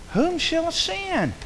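high, slow, ringing call; voice of the Lord. Acted. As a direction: let the divine call sail out slowly. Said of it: "you'll hear that call".

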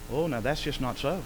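usual speaking voice

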